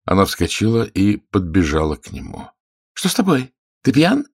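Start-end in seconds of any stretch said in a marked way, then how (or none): 2.9-4.3 high-pitched feminine questioning; sharp high-voiced surprise; startled lady. A narrator's reading. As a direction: ask in a high, taken-aback woman's voice. Narrator plays the startled lady.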